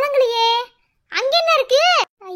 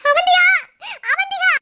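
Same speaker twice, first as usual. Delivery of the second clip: high, tearful cries for her; panicked wailing; 7 semitones higher, 4 dB louder than usual. Acted. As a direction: call out in rising panic to someone unresponsive. Panicking over unconscious girl. (பதறி அழுதபடி கூப்பிடுதல்)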